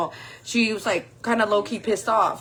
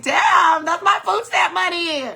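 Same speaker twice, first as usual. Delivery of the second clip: high, loud comic wail; mock outrage; 9 semitones higher, 5 dB louder than usual. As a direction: yell it high, half laughing. Mock outrage at stolen food.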